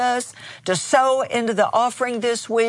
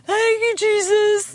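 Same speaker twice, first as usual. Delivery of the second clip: imitating a sobbing woman, much higher; half-choked tearful "Thank you, Jesus"; 11 semitones higher than usual. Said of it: "she's sobbing and sobbing".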